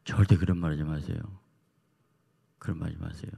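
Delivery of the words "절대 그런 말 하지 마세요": loud insistent no with 절대 hit hard; loud stern warning, stressing 절대; firm refusal. Retold or imitated. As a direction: shut the idea down firmly. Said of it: "그래서 내가".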